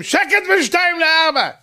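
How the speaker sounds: mock shout across the building; imitated neighbor's yell. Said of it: "השכנים היו צועקים".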